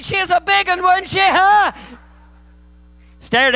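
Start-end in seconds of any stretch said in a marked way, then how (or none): 0.0-1.7 very high, loud mock exclamation; astonished shout. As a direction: holler in comic amazement, building to a huge "huh". Country preacher reacts astonished to misread verse.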